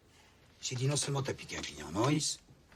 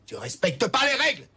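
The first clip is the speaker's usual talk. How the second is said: much louder and higher, furious; angry shout; 9 semitones higher, 10 dB louder than usual. Acted. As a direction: bawl him out for breaking the rules. Boss yells at the salesman.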